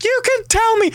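loud high-pitched coaxing mom; high pleading mother. Retold or imitated. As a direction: coax him in a shrill mother's voice. Mother still insisting.

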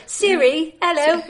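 lightly voiced British computer assistant; a half-hearted British computer voice; Siri. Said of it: "read it in the British Siri accent".

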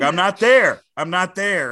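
shouted loud and high; loud cry. Retold or imitated. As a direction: yell it like the Good Will Hunting ending. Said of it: "I'm like".

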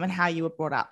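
normal talking voice

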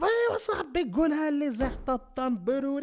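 very high mock-friendly cop; suddenly chummy policeman. Moroccan police discovering you know someone, suddenly chummy. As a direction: overdo the instant best-buddy act, high-pitched. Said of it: "dan ken je opeens iemand".

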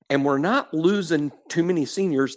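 ordinary delivery

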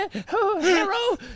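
high, slow eager hello; excited pet greeting. Pretend. Pet greeting owner at the door, high eager hello. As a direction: greet the owner like a happy little dog. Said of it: "I'm just like".

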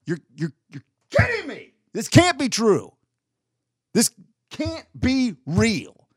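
high, slow, drawn-out disbelief; retold shocked reaction, high and drawn out; mock disbelief. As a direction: ham up stunned denial, slowly. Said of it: "I was like".

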